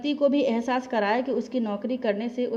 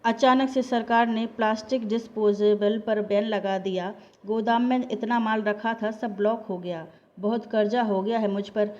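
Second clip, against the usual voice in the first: ordinary delivery, nothing marked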